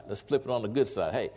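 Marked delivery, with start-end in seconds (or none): none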